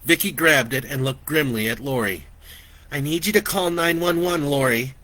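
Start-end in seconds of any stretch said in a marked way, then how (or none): none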